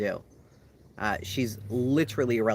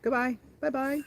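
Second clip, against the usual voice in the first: singsong mock goodbye; 14 semitones higher than usual. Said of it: "I said".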